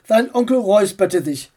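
usual speaking voice

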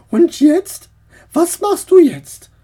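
aunt's high, loud demand; aunt asking. Acted. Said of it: "fragte Tante Samantha".

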